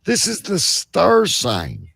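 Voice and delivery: ordinary delivery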